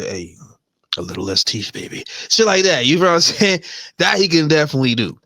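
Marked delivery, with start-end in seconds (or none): 0.0-2.0 much softer, lower murmur; hushed coaching. Acted. Man instructing his woman in private, acted hushed and low.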